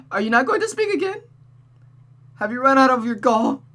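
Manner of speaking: high, loud crying plea to a silent mother; tearful daughter Rosa. Acted. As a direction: beg through tears, rising to near-hysteria.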